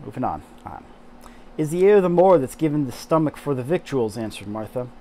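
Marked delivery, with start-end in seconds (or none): 1.6-4.2 Yorkshire read from spelling again; attempted Yorkshire accent. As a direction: reread Yorkshire line, louder. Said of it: "I'm terrible at accents".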